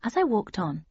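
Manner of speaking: usual speaking voice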